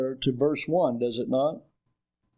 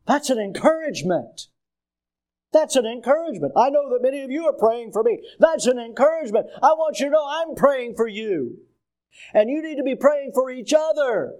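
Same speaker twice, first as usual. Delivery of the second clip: higher-pitched, emphatic pulpit exhortation; forceful preaching; 8 semitones higher than usual. Real, not acted. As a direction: press it on the congregation.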